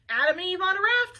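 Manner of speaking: loud, high diner-waitress holler. Acted. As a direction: holler the order through to the kitchen. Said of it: "the waitress hollered to a guy in the kitchen".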